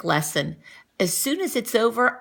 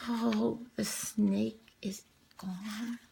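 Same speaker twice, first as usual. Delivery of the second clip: slow, soft whisper; spooky quiet whisper; suspenseful whisper; 10 dB quieter, slower than usual. A narrator's reading. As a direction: hush it with suspense.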